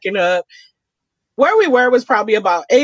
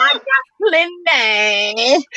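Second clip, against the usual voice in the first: very slow, put-on character voice; mock movie quote; much slower than usual. What she thinks of on seeing the flashing, a slow quoted voice. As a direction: drawl it out like a film line. Repeating the silly line.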